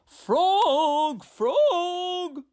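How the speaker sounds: high loud call. A narrator's reading. Toad calls at Frog's house, high and loud. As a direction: call him from outside the door. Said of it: "cried Toad".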